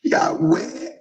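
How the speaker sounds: ordinary delivery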